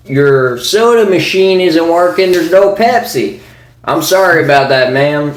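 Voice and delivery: lightly put-on country accent. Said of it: "country accents".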